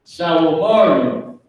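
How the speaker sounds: slow, drawn-out Hebrew recitation; kids reciting back. Acted. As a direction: stretch each word out as the kids' answer. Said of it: "They gonna say".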